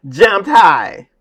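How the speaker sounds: sudden loud, high burst of fake happiness; forced happy. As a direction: imitate a student switching happiness on abruptly. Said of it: "make it happy".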